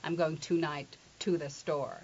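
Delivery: "tonight" and "to" with full oo; over-careful learner's to. Retold or imitated. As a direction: say too-night, then too the store. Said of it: "people who learn English as a second language always say".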